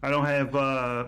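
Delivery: ordinary talk, nothing marked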